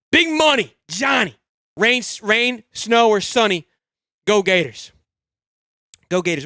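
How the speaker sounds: usual speaking voice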